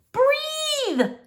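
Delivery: high, urgent cry of breathe; sharp high rescue shout; shouted command. Acted. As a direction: call out breathe to revive him. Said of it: "the new voice shouted".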